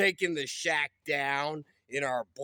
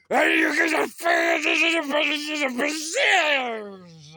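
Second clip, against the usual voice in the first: fast, higher manic yell; frantic shout; 8 semitones higher, 6 dB louder than usual. Acted. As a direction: rant like a madman. Ranting about the shack.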